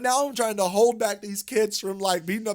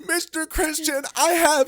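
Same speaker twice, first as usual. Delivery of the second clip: imitating a crying kid, higher and teary; 10 semitones higher, 4 dB louder than usual. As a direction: mimic the nerdy twelve-year-old on the verge of tears. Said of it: "and he's crying".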